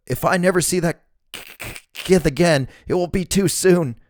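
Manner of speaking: high, stammering nervous thug; high-pitched man. A narrator's reading. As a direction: stutter it out high and scared. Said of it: "the one with the high-pitched voice".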